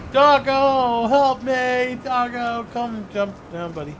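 high, drawn-out wail for help; mock pleading call. Player begs the dog to jump down, wailing. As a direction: call out high, stretching each word.

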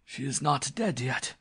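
high, strained gasp; breathless, frightened protest. A narrator's reading. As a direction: blurt it in fear for your life. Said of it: "he gasped".

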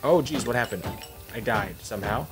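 usual speaking voice

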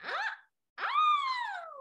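short gasp, then long high squeal; very high delighted shriek; excited squeals. Acted. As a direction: squeal with acted amazement. Bella reacting to eight horses.

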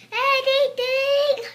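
high, loud and slow plea; desperate cry. Acted. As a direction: beg for any help at all.

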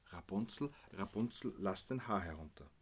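softly reading the sign's words, Rapunzel, lass den Haar herunter; read off quietly. Retold or imitated. Gabi reading the sign to herself, quietly. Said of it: "Gabi las".

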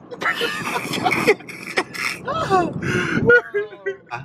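a long wailing laugh; long high-pitched cackle. Real, not acted. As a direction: laugh until it sounds like crying. Laughing in the Uber.

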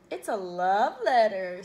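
loud, drawn-out singsong tease; slow, swooping sisterly taunt; teasing. A narrator's reading. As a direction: draw out "love" to needle a brother.

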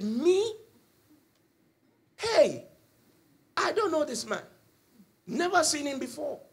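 high-pitched mock protest; indignant denial. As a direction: act out Peter protesting innocence in a high voice. Peter indignantly denies knowing Jesus.

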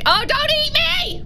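high, slow pleading cry; a playful plea of fake panic; mock terror. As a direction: beg not to be eaten, hammed-up. Game character pleading, mock fear.

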